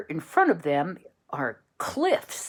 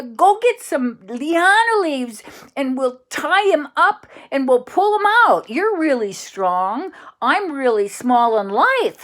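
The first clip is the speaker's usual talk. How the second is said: very high, loud, eager calling out; urgent idea; 9 semitones higher, 7 dB louder than usual. Acted. Tori the Minotaur calls to Rex with an urgent rescue plan. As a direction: shout the plan fast, then spell it out.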